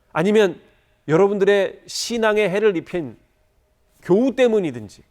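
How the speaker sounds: impassioned preacher at full voice; forceful preaching. Real, not acted.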